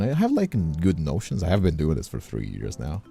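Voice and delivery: usual speaking voice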